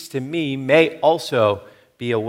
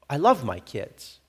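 ordinary talk, nothing marked

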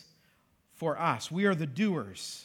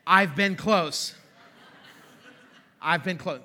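laughing; 3 semitones lower, slower than usual. Real, not acted. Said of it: "laughing confession".

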